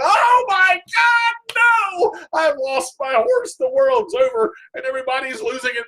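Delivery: shrill hammed-up wail over the lost horse; mock despair. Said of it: "I'm going".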